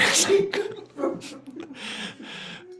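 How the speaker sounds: high laugh tailing off; high-pitched giggling fit after an apology; real laughter. Real, not acted. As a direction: burst out laughing at yourself. Laughing at own shortcomings.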